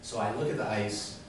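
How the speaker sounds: normal talking voice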